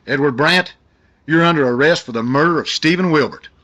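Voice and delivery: loud, firm police command; stern arrest. Acted. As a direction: arrest him with force. Cop arrests the killer.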